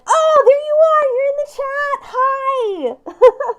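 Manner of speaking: high, loud, thrilled hello to a viewer; excited greeting. Real, not acted. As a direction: squeal it out, delighted to see them. Spots gift sender in live chat.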